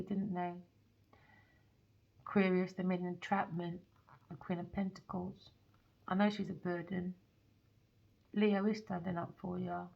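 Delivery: hushed, close comforting read; soft intimate reading. Real, not acted. Tarot reader soothing the viewer, hushed. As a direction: speak softly to the listener.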